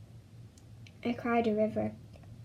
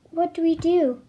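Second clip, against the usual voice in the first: tearful, raised plea; crying; 5 semitones higher, 6 dB louder than usual. A narrator's reading. A boy asking his mother after his dad's death, crying. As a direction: ask through tears, high and loud. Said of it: "I asked, crying".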